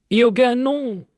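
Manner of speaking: high, desperate scream of no. Acted. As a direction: scream it out to stop her. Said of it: "hurle-t-elle". (hurlement désespéré et aigu)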